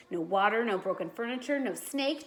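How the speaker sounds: plain reading voice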